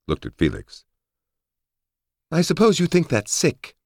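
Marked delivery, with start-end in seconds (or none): 2.3-3.8 put-on very high, bright girlish voice; Holly voice. A narrator's reading. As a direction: pitch way up, chirpy.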